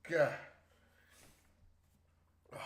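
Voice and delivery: normal talking voice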